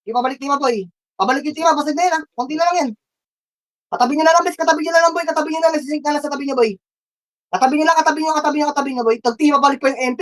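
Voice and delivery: high, insistent repeated orders. Real, not acted. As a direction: keep pushing the team, keyed up. Urgent calls to teammates.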